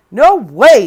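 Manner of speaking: loud scoffing wolf. Acted. Wolf brushing Jack off, loud scoff. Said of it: "says the wolf".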